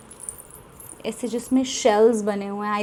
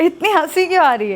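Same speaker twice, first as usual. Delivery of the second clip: laughing as she asks, high and bright; laughing question; 6 semitones higher, 4 dB louder, faster than usual. Real, not acted. Laughing while asking the giggling girl why she laughs.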